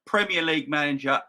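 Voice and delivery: ordinary delivery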